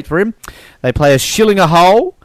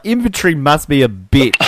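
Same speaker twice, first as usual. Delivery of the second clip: ordinary talk, nothing marked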